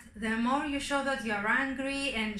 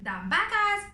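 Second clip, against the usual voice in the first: silly wrong word; 9 semitones higher, 5 dB louder, slower than usual. Acted.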